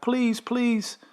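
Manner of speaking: high, pleading please; imitating a begging wife. Wife begs intruders at her door, high pleading. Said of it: "his wife is like".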